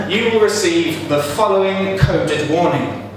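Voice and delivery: ordinary delivery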